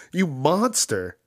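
hammed-up outrage; mock horror. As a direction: play scandalized. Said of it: "You're like".

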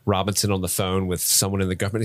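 ordinary delivery

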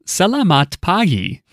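high, slow voice-over with a smile; imitation of a smiley narrator lady; smiling TikTok voice. Shown as a demonstration. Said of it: "sound like you're smiling".

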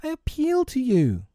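high, pleading cry; desperate appeal. A narrator's reading. Wendy turning to Tootles for help, high pleading cry. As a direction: appeal to him, voice pitched high. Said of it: "she cried".

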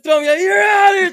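high, loud, ejecting call; umpire's shout. Retold or imitated. Umpire throwing the pitcher out, loud shout. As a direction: yell it like an umpire tossing a player.

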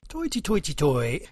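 Irish leprechaun. Acted. St Patrick's leprechaun bit.

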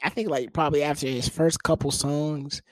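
ordinary talk, nothing marked

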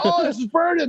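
mild high mock yell of pain; retold scream. Storyteller imitating himself burned by food, mock screaming. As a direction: cry out in pain, pitched high without real volume. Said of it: "I'm just, like, screaming".

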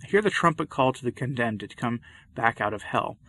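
ordinary talk

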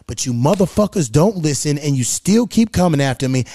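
ordinary talk

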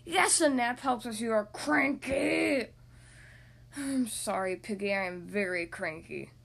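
slow, low tired elephant Gerald; थका चिड़चिड़ा हाथी, धीमी नीची आवाज़; tired cranky elephant. Acted.

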